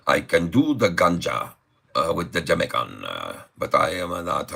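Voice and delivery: put-on a tentative, barely-there Jamaican accent; attempted Jamaican accent. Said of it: "I can't do the Jamaican man".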